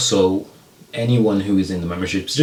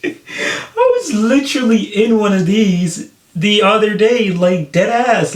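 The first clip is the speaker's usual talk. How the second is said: excited; 12 semitones higher, 3 dB louder, slower than usual. Real, not acted. Excitedly recalls being in the truck.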